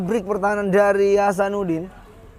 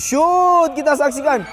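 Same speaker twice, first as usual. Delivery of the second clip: high loud rising call; excited shout; 9 semitones higher, 5 dB louder, slower than usual. Real, not acted. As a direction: shout it as the shot flies. Commentator calling a long shot.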